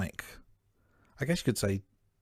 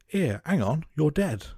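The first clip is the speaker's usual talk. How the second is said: higher, louder Cockney soldier's voice, casually objecting; Cockney accent impression; 6 semitones higher, 4 dB louder, slower than usual. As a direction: do a casual Cockney squaddie calling out. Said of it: "that was not a bad accent".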